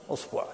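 "أصبع" as usbu', u in both syllables; damma on hamza. Shown as a demonstration. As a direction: say "أصبع" with u first and u after the b. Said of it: "ضم الهمزة". (نطق "أصبع" بضم الهمزة والباء)

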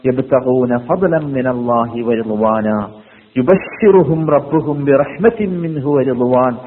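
slow, measured Arabic recitation; unhurried scripture recital; Quran recitation. Real, not acted. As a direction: recite the verse with drawn-out syllables. Quoting Quran verses in recitation.

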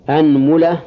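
أنملة as "anmula", a first, u after mim; fatha then damma. Shown as a demonstration. Teacher listing the fatha set, أنملة as "anmula". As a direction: say أنملة with opening a and middle u. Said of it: "هذا في الفتح".

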